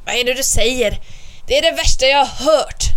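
loud, angry tiger growl; angry roar. A narrator's reading. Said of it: "röt tigern ilsket". (arg tiger som ryter)

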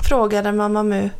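usual speaking voice

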